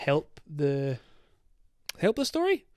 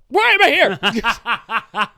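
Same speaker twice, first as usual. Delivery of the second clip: very slow, higher and louder through laughter; laughing disbelief; 5 semitones higher, 8 dB louder, much slower than usual. Retold or imitated. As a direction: drag it out laughing at the absurdity. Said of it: "So I was like".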